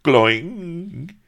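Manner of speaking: high, loud comic yelp; sharp gloing. Acted. Avery sees the lady and yelps, high and loud. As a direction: yelp it out in surprise. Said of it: "ended his sentence with a sharp".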